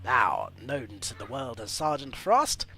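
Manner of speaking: hammed-up trailer narration, slowing for effect; dramatic bio read. Streamer reading a game hero's backstory, in mock trailer style. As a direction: read it like a movie trailer voice-over.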